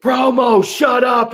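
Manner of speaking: loud barked shout at the promo; retold shout at a wrestler cutting a promo; mock shout. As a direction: yell it to shut them up. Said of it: "you're like".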